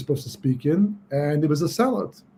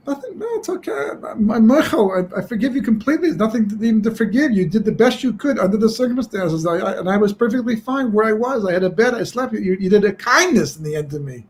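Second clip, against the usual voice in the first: gentle reassurance; 6 semitones higher than usual. Acted. Rabbi consoles weeping innkeeper.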